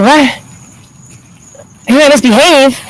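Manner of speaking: high-pitched, disbelieving repeat; retold "you cannot be serious" reaction; incredulous echo. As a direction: throw it back at them, pitch jumping up. Wife echoes staff in disbelief.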